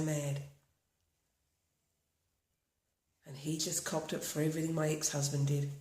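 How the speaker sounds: walk them through tapping calmly, line by line; guided tapping script. Acted. Leads a tapping routine.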